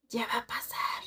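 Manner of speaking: tense hushed whisper. Retold or imitated. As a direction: Omar whispering that it is about to happen. Omar warning in the dark.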